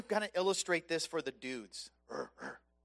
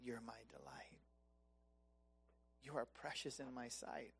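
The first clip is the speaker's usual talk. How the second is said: hushed, breathy whisper of loving reassurance; God's tender whisper; 7 semitones lower, 16 dB quieter than usual. Acted. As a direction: whisper it like God bending close. God speaking tenderly to believer.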